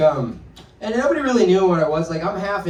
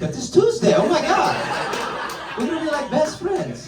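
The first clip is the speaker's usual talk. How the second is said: very high, giddy squeal of recognition; gushing delight; 11 semitones higher than usual. Acted. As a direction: gush like you just found your best friend. Acted delighted new best friend.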